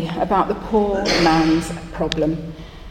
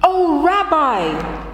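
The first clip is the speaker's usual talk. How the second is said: high, slow, beaming; poor man beaming at the rabbi in relief; big smile; 7 semitones higher, much slower than usual. Acted. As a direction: sigh it out in relief and joy. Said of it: "with a big smile on his face".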